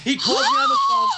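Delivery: mock high creepy voice; imitating creepy voice. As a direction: squeak it like the creepy caller. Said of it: "with that voice".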